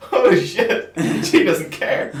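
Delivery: shocked laughing gasp. Retold or imitated. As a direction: laugh in disbelief. Shocked laughing reaction retold.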